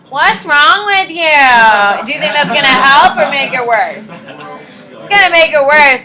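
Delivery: loud, slow, pushy classmate voice; mocking peers. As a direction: needle the panicking kid like a rude peer. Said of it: "How do you think your peers are gonna do".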